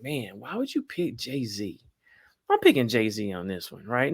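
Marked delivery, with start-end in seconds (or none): 0.0-1.8 whispered, slow mock question. Imitating the audience doubting his pick, whispered. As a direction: hush it like a doubtful audience member. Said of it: "I know y'all like".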